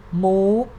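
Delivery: high rising tone on move; high-tone demo. Shown as a demonstration. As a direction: move as 'moo' lifting high. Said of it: "it is the high tone".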